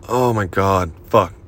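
ordinary delivery

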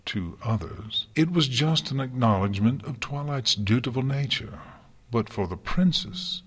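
plain reading voice

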